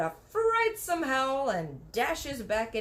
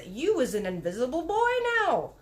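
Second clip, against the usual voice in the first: excited; 4 semitones higher, 4 dB louder than usual. A narrator's reading. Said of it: "squiffy with excitement".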